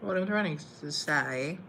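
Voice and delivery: usual speaking voice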